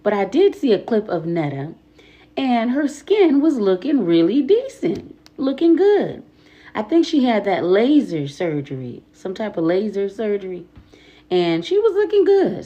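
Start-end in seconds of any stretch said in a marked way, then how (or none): 2.4-12.6 excited. Real, not acted. Excited praise of [name].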